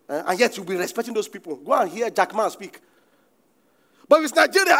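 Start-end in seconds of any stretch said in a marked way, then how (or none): 4.1-4.8 raised preaching. Acted. Preacher raising voice.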